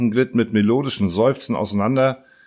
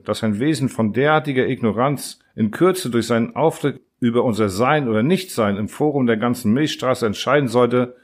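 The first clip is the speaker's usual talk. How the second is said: usual speaking voice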